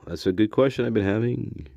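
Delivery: ordinary delivery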